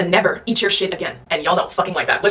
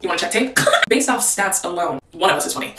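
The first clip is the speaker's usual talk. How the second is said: usual speaking voice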